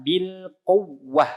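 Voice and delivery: Bil quwwah very slow, ww pushed up; nabr stress. Shown as a demonstration. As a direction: lean on the ww of quwwah, slow and drawn. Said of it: "Itu naber pada wawu tasdid".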